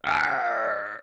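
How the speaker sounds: low, drawn-out pirate arr; a pirate's low arr, half laughing; pirate growl. Pretend. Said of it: "Sounds like a pirate".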